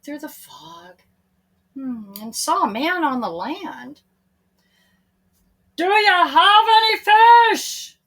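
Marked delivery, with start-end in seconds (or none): 5.8-8.0 higher, louder call across the lake; calling from shore. A narrator's reading. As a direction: call out from far away. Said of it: "the man called again".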